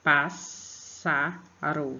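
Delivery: very slow, each syllable of Pássaro split apart; Pássaro stretched out slowly, syllable by syllable. Shown as a demonstration. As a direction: say Pássaro as pás-sa-ro, pausing between syllables. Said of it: "Pronuncie a palavra pausadamente".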